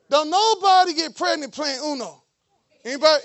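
usual speaking voice

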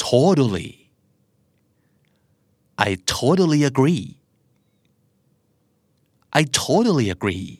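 "totally" as "toh-duh-lee"; American flap T. Shown as a demonstration. Teacher drilling learners with a phrase, flap T. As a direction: keep the second T of "totally" a quick D. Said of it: "อเมริกันออกเสียงว่า".

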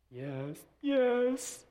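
put-on high eager little-kid yes; lightly voiced child. Child agreeing to obey dad, high and eager. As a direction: answer like a small obedient child.

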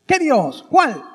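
high, loud pointed challenge; challenging question. Acted.

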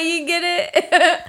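high, laughing and drawn out; giggling. Real, not acted. Teller cracking up at her own pirate joke, giggling. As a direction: giggle out the question.

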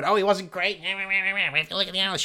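high, whiny mimic of a know-it-all fan; lightly voiced snooty critic; mocking critic. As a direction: parody of an analyst-loving fan.

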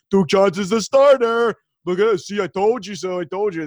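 mock a know-it-all fan crowing loudly; stupid fantasy fan voice. Host mimics fans after Duke Johnson fallout, loud mocking dumb voice. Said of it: "the stupid fantasy person voice".

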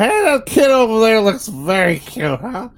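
loud, high mock gushing over a kid; exaggerated high-pitched barfly chatter; hammed-up bar patron. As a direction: imitate a tipsy patron calling across the bar. Acting out tipsy bar patrons.

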